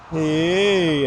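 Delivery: ordinary talk, nothing marked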